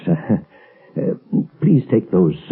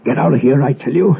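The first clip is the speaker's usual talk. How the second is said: angry; 5 semitones higher, 3 dB louder than usual. Acted. Professor orders the intruder out.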